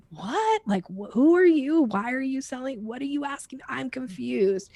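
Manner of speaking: baffled at a sudden sales pitch; confused buyer. Retold or imitated. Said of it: "people are going like".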